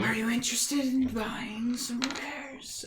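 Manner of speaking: breathy creature whisper; raspy whisper. Acted. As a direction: whisper it like an eerie shopkeeper. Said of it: "but not out loud".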